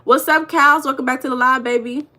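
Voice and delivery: usual speaking voice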